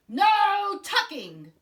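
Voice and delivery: berro muito lento, agudo e alto; tripulação gritando. Acted. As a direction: grite indignado em coro. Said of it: "the crew cried".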